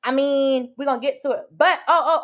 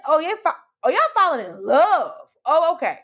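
mock realization; 4 semitones higher than usual. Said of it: "it's like".

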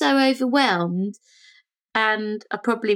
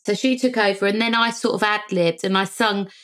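usual speaking voice

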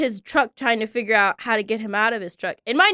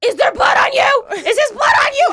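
panicked mom; 12 semitones higher, 4 dB louder than usual. Retold or imitated. Mom panicking over phone.

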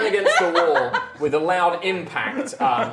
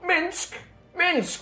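calling a name, higher; 14 semitones higher than usual. Acted. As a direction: call across the room. Calling out to Minsk.

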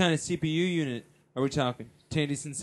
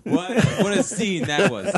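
ordinary delivery

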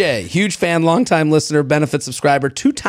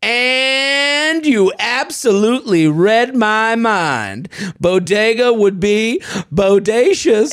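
slow, drawn-out goofy texter; over-pleased mock-cool dad voice; wannabe-cool dad; 2 semitones higher, slower than usual. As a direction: ham up the cringey wordplay. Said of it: "Okay, here's him".

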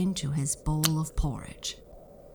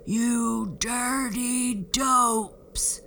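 very slow, loud, much higher furious boom; giant booming; 8 semitones higher, 4 dB louder, much slower than usual. Acted. Giant finding cobwebs, slow furious boom. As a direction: boom each word at the children. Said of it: "boomed the giant".

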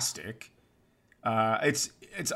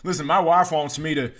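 higher, louder mock-unhinged rant; unraveling rant; 6 semitones higher, 9 dB louder than usual. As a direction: ham up a man coming apart on the phone. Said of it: "you were gonna unravel".

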